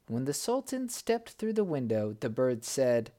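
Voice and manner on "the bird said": usual speaking voice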